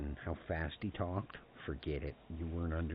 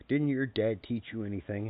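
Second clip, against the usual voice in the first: raised, louder imitated reproach; retold indignant question, higher and louder; mock scolding grandpa; 4 semitones higher, 7 dB louder than usual. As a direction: playfully scold like an exasperated grandpa.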